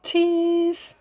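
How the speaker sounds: high sing-song cheese for a photo; saying cheese. Retold or imitated. Family posing for photo, high cheese. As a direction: mimic everyone posing for camera. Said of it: "Everyone's saying".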